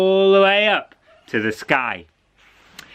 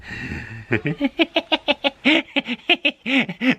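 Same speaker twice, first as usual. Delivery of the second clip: gleeful cackle; 12 semitones higher than usual. Acted. Cat delights in misery.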